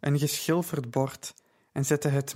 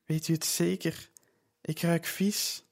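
small trembling voice; 3 semitones higher, 3 dB quieter than usual. Acted. Ashamed girl asks Lydia if she is sure, small trembling voice. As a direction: shrink into a tiny wavering voice. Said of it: "met een klein beverig stemmetje".

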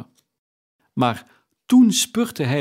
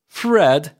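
louder, higher call of the name; calling out Fred; 4 semitones higher, 7 dB louder than usual. A narrator's reading. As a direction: call out to him from the door. Scrooge calls his nephew.

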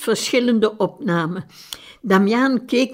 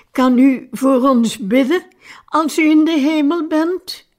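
higher-pitched, mildly tearful question; understated sobbing plea; 6 semitones higher, 3 dB louder than usual. A narrator's reading. Sister asking the dying priest for prayers, sobbing lightly. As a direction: plead gently, pitch lifting to the end. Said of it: "snikte ze".

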